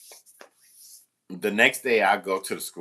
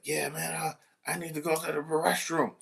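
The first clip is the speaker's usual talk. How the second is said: put-on higher, casual teen voice; lightly voiced kid wanting out; a hint of a restless teen asking; student asking; 5 semitones higher, 6 dB quieter than usual. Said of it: "he was like".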